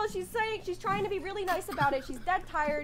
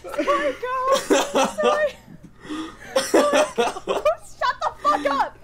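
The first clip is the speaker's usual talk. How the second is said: laughing; 6 semitones higher, 4 dB louder, slower than usual. Real, not acted. Laughing trying to tell story.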